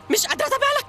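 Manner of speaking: the same words shooting up in pitch; panic jumping up. Acted. Panic spiralling, repeated line.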